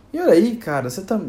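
exasperated owner scolding a messy pet. Pretend. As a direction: tell off a pet who wrecked the flat. Scolding the tiger for the mess.

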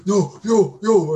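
mock freaking out; imitating a kid in a panic; panicked denial. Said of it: "And he's like".

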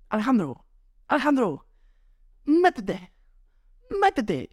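high urgent calling out. Retold or imitated. Friend on the shore calling Alejandro back in, high and urgent. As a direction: call him back into the water, urgently. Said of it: "me grita un amigo".

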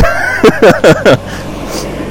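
loud burst of laughter. Real, not acted. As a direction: laugh out loud at own joke. Jokes about calling him the Great.

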